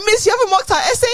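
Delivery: mock student plea. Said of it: "I'm like".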